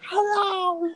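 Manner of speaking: very high squeaky silly voice; put-on voice. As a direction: pitch it way up as a joke character. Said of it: "Oh, you're Chinese now?".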